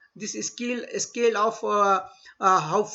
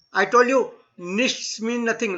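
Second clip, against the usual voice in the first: ordinary talk, nothing marked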